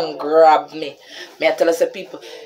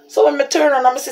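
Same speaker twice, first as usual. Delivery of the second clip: normal talking voice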